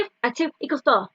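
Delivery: rapid-fire counting; fast. Shown as a demonstration. Teacher racing through the sum for children. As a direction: rattle it off fast. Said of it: "see how fast we can say it".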